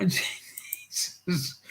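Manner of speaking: breathy whispered "Jesus" through a laugh; real amused whisper. Real, not acted. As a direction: let it slip out as a laughing whisper. Laughing at a chat joke.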